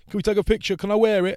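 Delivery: high, loud eager request; high loud fan pleading for a photo. Retold or imitated. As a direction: beg like an excited fan. Fans asking for picture.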